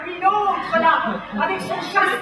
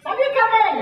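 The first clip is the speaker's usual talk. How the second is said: higher and louder hello across the street; calling out; 8 semitones higher, 6 dB louder than usual. Real, not acted. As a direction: hail a neighbour from afar. Greets neighbour across street.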